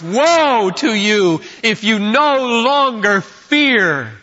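preaching at full force. Real, not acted. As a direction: pronounce woe with slow, heavy emphasis. Thunders a woe at congregation.

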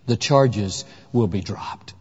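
low, slow, soft judge's ruling; solemn gentle verdict. A narrator's reading. Preacher voicing the heavenly judge freeing the guilty, softly. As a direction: let the verdict fall slowly and softly. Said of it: "to the gasps of everybody".

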